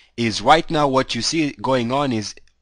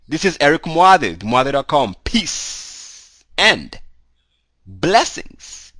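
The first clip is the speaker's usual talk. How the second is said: high, bright channel outro; upbeat sign-off; 5 semitones higher, 4 dB louder, much slower than usual. Real, not acted. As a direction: sign off with energy like a host.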